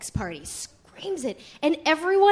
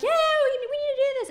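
very high eager voice; excited agreement; 13 semitones higher, 4 dB louder than usual. Retold or imitated. Christiane agreeing to join the plan, very high and eager. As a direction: jump in eagerly.

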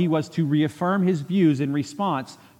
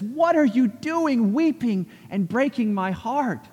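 high, slow hurt question; slow wounded plea to weeping friends; pained rebuke; 8 semitones higher, slower than usual. Retold or imitated. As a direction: ask it like your heart is breaking. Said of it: "Paul is upset with them".

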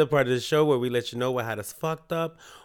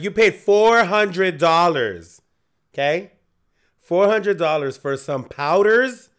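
incredulous; 3 semitones higher, 8 dB louder than usual. Retold or imitated. Retold scolding of a friend.

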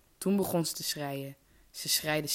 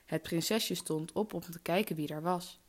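usual speaking voice

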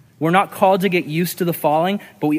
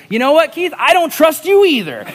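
high, loud retold comeback; angry retort; 11 semitones higher, 7 dB louder than usual. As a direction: fire back at the neighbor. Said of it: "My response in the flesh was".

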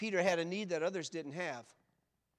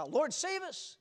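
high, raised-voice plea; crying out; 10 semitones higher, 6 dB louder, much slower than usual. Acted. Preacher acting out Peter's cry for help, high and loud. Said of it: "they didn't cry".

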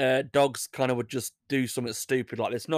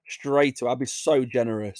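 ordinary delivery

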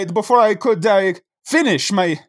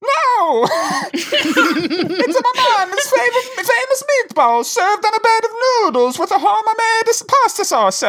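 much higher mock Italian accent with laughs; 12 semitones higher than usual. Ghost describes mom's recipe, in a high laughing Italian accent. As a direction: gush about mama's dish, hammed-up Italian. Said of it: "make me do an accent".

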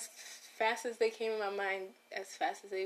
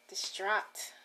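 ordinary delivery

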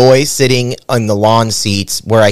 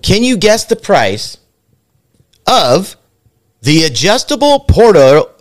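higher-pitched host read, with big pauses; game show announcer; 7 semitones higher than usual. Pretend. Host asking contestants to guess the price, announcer voice.

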